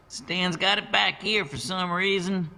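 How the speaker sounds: high, offhand voice of a big warehouse man; Bert shrugging. A narrator's reading. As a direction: toss it off with a shrug. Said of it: "Bert shrugged".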